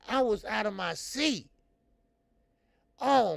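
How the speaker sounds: loud, high and hyped; excited shout. Real, not acted. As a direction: shout it like you jumped out of your seat. Hyped about last fight.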